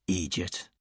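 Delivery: low, soft, dry "idiot"; dry insult. Acted. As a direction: tack on "idiot" deadpan, under the breath. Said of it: "slightly annoyed".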